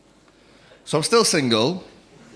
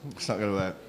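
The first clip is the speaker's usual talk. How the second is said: quiet; 8 semitones lower, 8 dB quieter, faster than usual. Acted.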